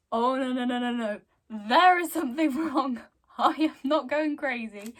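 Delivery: loud, rushed denial; no no no, rising panic; panicked. Retold or imitated. Selena panicking about the creepy doll, loud.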